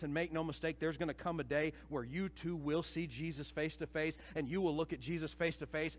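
usual speaking voice

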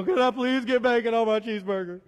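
loud, high comic pleading; mock begging. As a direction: beg hammed-up, loud and high. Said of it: "I probably still beg".

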